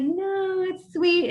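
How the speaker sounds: high, sing-song brush-off; high insisting reply to friends; playful insistence. Retold or imitated. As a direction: chirp it back brightly to the doubters. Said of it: "I was like".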